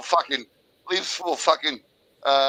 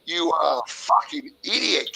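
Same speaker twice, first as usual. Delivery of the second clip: normal talking voice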